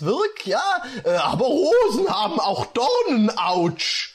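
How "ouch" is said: ordinary talk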